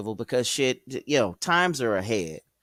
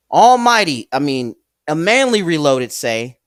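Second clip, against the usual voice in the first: high loud burst of excitement; excited shout; 3 semitones higher, 11 dB louder than usual. Real, not acted. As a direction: call it out big and high. Excited exclamation reading chat.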